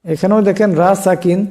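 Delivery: usual speaking voice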